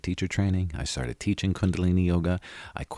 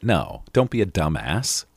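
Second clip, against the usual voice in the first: normal talking voice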